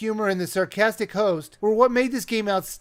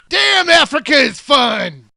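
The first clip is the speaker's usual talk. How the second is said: loud, slow, gleeful hunter yell; slow gleeful shout; 5 semitones higher, 8 dB louder, slower than usual. Acted. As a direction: drawl it out like a happy yahoo. Hunter voice continues.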